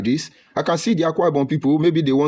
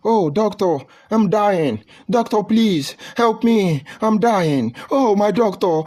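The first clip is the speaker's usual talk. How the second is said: yelling in pain; 8 semitones higher, slower than usual. Retold or imitated. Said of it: "yelling at the top of his voice".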